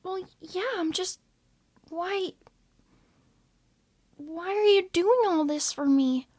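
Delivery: high, soft and shy; a small, unsure voice; timid Cookie. Acted. Cookie asking Shadow how long he slept, high soft timid voice.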